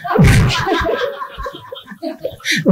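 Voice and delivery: a high, unvoiced burst of laughter at herself; real airy laugh; breathless laugh. Real, not acted. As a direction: laugh off the failed accent. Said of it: "A mí me sale gallego".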